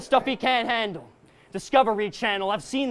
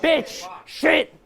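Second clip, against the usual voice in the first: louder burst of curses; mock angry cursing; 5 semitones higher, 4 dB louder, much slower than usual. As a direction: spit the swears out like you're mad. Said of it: "I gotta get mad and curse".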